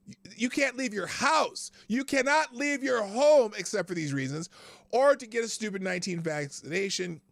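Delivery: venting at lockdown rules, exasperated and loud; exasperated rant. Real, not acted. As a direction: hammer each restriction home in disbelief. Ranting about lockdown rules.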